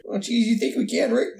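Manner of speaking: high, nervous cartoon sidekick; Morty. Friend answers as Morty to Rick, hammed-up anxious cartoon voice. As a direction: put on a fretful, high-pitched stammering kid voice. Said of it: "He sounds like an Adult Swim cartoon character".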